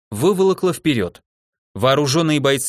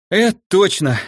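breathless after the fight; panting out of breath; 6 semitones higher, 4 dB louder than usual. A narrator's reading. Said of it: "пытаясь восстановить дыхание после боя".

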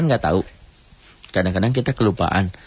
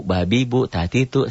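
ordinary delivery

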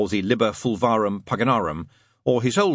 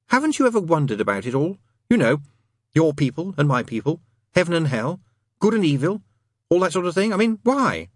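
higher-pitched questioning demon; Crowley; 7 semitones higher, 3 dB louder than usual. Acted. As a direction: build the questioning, climbing in pitch to the end.

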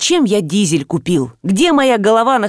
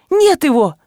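high-pitched cry of alarm; restrained yell, pitched up; 8 semitones higher than usual. A narrator's reading. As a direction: exclaim it in distress. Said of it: "закричала Галя".

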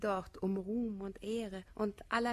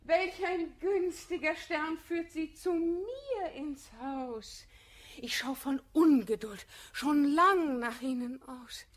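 fake sweetness; 6 semitones higher, 6 dB louder than usual. False sweet greeting to rival.

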